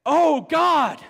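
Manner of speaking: loud, high cry of awe; awed exclamation. Retold or imitated. Preacher voicing Paul's amazement, loud and high. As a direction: burst out in wonder. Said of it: "It's like he's saying".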